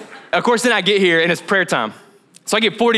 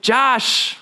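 higher firm voice of God; higher, firm rebuke to Josh; God's rebuke; 7 semitones higher than usual. Retold or imitated. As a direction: set him straight, firmly. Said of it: "He was like".

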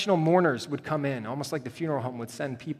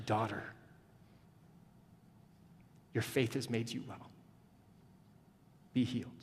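hushed and loving; tender; 4 semitones lower, 8 dB quieter than usual. Acted. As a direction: say it gently, as a blessing. Jesus speaks tenderly to woman.

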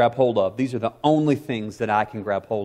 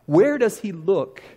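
quoting Spurgeon; 5 semitones higher than usual. A narrator's reading. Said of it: "And Spurgeon wrote".

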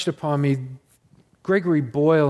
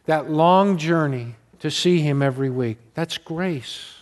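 normal talking voice

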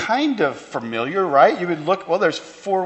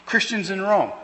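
ordinary talk

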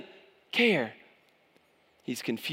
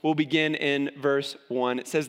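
ordinary talk

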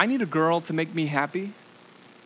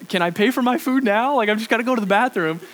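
urgent plea; 6 semitones higher, 5 dB louder than usual. Retold or imitated. Begging hostess to use the bathroom.

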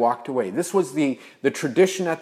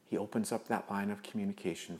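normal talking voice

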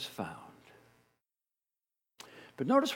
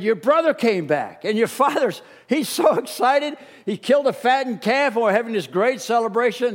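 higher, louder, bubbling with news; excited servant; 6 semitones higher, 5 dB louder than usual. Acted. Servant telling the older brother about the party, higher and bubbling. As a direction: gush out the good news. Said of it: "the servant said".

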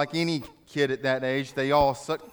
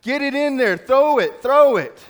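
much higher, louder frantic shouting; parents yelling; 11 semitones higher, 11 dB louder than usual. Retold or imitated. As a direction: scream it from the stands. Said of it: "in the stands are yelling".

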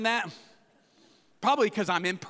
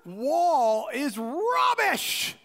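shouted sneer in a put-on English accent, much higher; mocking English accent; 9 semitones higher, much slower than usual. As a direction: Tobiah jeering slowly at the wall. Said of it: "just completely mocking them".

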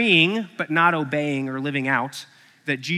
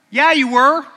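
sarcastic retort; 9 semitones higher, 10 dB louder than usual. Acted.

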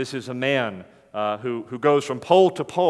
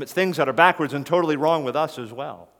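ordinary delivery

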